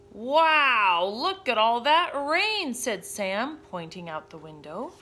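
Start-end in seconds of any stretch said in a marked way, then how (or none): 0.1-2.7 loud, drawn-out wonder; slow, wowed storybook kid; amazed. Acted. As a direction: gape at the downpour. Boy amazed by the rain.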